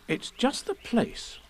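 put-on high, slow, hushed boy's voice; a boy explaining in secret. A narrator's reading. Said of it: "he explained".

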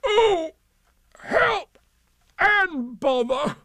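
very high, drawn-out wail; distressed cry. Acted. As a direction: wail each word separately for help. Pooh calls out, stuck fast.

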